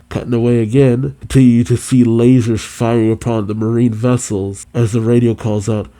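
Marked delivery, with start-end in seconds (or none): none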